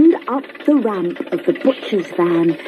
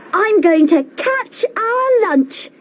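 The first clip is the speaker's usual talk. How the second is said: put-on much higher little boy's voice, eager; 13 semitones higher than usual. A narrator's reading. Little brother boasting about catching lunch, high and eager. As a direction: pipe up like an excited small boy. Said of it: "he said".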